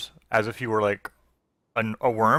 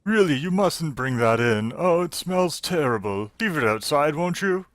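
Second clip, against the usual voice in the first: fussy objection, rising higher; Sinclair; 4 semitones higher than usual. Acted. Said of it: "you hear the voice of Sinclair saying".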